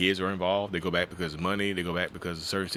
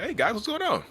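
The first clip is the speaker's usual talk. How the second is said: fake cheerful. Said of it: "He was so happy and cheerful".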